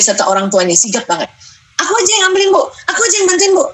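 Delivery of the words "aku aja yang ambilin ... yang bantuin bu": put-on high, bright little-kid offer; eager child voice. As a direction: jump in like a keen child volunteering.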